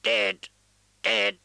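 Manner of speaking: high squawking bird croak; raven voice. Acted. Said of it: "the raven said".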